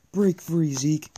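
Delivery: loud, high urging cheer; excited cheering. Real, not acted. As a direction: urge the runner on, loud. Cheering on player in game.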